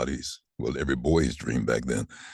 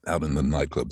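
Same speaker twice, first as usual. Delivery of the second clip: ordinary talk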